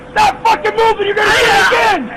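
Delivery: angry shout. Real, not acted. Officer shouts at struggling man, angry and loud. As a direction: yell it while wrestling him.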